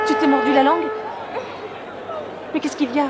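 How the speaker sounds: startled, anxious concern; alarmed questioning. Acted. Alarmed at child's injured mouth.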